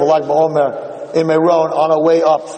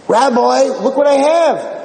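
excited show-off; 9 semitones higher than usual. Retold or imitated. Proud excited girl.

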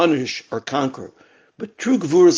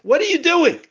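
imitating a screaming man, higher pitch; retold screaming; 10 semitones higher than usual. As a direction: play the injured man's high, frantic yelp, half-acted. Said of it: "And the man starts screaming".

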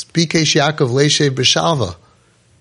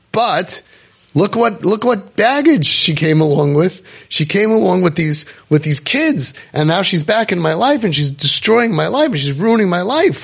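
mock complaint; 6 semitones higher than usual. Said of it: "you should say".

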